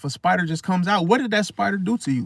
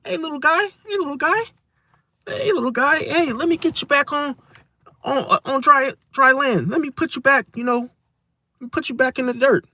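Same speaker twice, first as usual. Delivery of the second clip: tender to a spider; 7 semitones higher than usual. Retold or imitated. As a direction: coo at it gently, like rescuing a tiny creature. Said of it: "they would be like".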